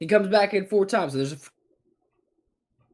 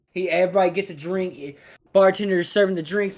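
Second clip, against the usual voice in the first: ordinary delivery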